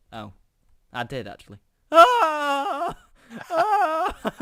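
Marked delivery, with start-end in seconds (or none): 1.9-4.3 loud high scream of pain; burning scream. Acted. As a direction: scream like you're on fire. Screams standing in lava.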